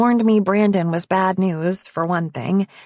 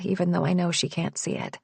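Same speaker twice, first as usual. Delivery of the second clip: ordinary delivery, nothing marked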